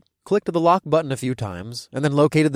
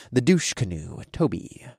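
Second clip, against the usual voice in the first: ordinary delivery, nothing marked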